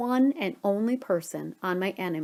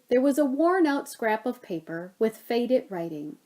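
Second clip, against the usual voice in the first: plain reading voice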